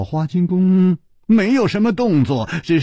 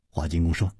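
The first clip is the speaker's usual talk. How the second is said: ordinary delivery, nothing marked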